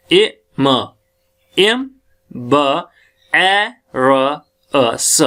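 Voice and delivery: each sound voiced alone, slowly; sounding out phonemes. Shown as a demonstration. Teacher answering which sound comes, sounding out each one. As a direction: separate sounds "ए", "म", "ब", "र", "स" with gaps. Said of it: "तो अब क्या sound आएगी".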